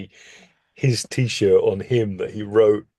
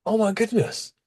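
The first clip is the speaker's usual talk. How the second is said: amazed; 13 semitones higher than usual. Retold or imitated. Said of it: "And I'm like".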